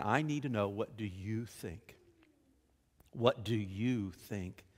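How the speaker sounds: soft, low, slow and deliberate question; pointed. Retold or imitated. Jesus cutting through other influences, quietly pointed. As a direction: drop low and soft, spacing out each word. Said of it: "it's very pointed".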